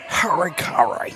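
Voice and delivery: raspy mock call. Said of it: "That's what my raspy voice kinda reminds me of".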